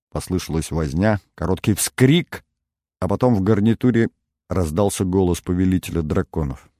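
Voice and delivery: usual speaking voice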